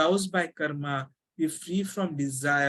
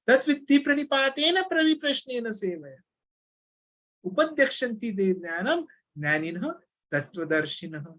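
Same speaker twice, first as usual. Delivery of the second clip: shloka recitation; 6 semitones higher than usual. Acted.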